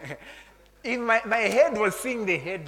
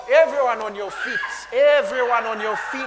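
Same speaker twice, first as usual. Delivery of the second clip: rousing call; 4 semitones higher, 10 dB louder than usual. Real, not acted. Preacher telling everyone to stand, rousing shout. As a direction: call the whole room up.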